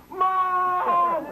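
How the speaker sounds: put-on high, loud shout of a hurt little boy; a son yelling for his mother; child calling mom. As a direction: call out for mom like a boy with a bruised finger. Son calls mom after bruised finger.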